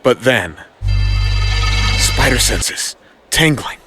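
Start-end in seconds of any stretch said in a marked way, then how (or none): none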